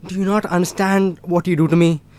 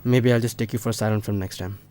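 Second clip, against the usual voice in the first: quiet, much lower jibe; low, cutting aside; 8 semitones lower, 8 dB quieter than usual. Acted. Boyfriend ending the quarrel, quietly snide. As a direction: drop the volume and deliver the dig.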